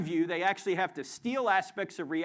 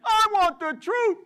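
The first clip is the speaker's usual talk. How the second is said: much higher, louder yell; shouted demand; 14 semitones higher, 10 dB louder than usual. Pretend. Leading audience in the movie line, full yell. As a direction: belt it out to lead the crowd. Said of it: "let's try that again".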